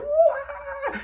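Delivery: very high, loud mock wail; imitated crying wail. Babysitter crying at the Beatles, mock wail. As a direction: wail like a hysterical fan, in imitation. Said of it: "And she's just crying".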